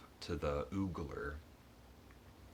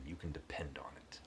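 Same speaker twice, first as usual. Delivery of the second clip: whispered boast; 7 dB quieter than usual. Retold or imitated. Duelist's confident boast.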